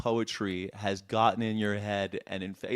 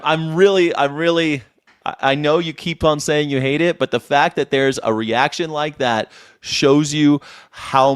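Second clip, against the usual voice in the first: much louder to beat the TV; 9 dB louder than usual. Real, not acted. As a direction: raise your voice over the noise. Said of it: "I'll do it right now".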